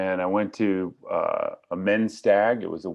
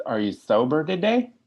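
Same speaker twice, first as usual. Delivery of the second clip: higher, syrupy mock-sweet question on "today"; sweet phone volunteer; 7 semitones higher, 5 dB louder than usual. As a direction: put on the sugary tone of an AA phone volunteer, rising on "today". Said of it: "that's how you people talk when I'm new".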